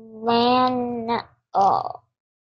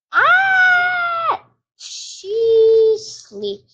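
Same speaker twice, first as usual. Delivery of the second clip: surprised shriek; 11 semitones higher, 4 dB louder, much slower than usual. Real, not acted. Shrieks on seeing friend asleep.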